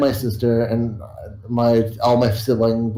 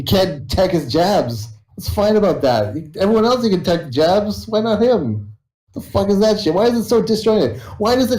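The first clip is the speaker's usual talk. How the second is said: higher-pitched indignant complaint; angry rant; 9 semitones higher than usual. Real, not acted. Gamer ranting to chat about an unfair character, angry. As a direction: vent the frustration, voice climbing.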